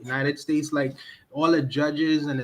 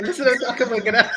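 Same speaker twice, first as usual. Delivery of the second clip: laughing and repeating it louder; amused, teasing, higher and louder with a laugh; 7 semitones higher, 4 dB louder, faster than usual. Real, not acted. Friend laughing at Kevin's guinep talk, laughing teasingly.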